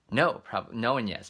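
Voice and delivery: ordinary delivery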